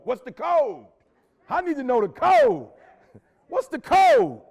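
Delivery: loud, high and drawn out on the key word; emphatic demand. Real, not acted. Speaker urging students to seek the code, emphatic demand. Hammering home his main point.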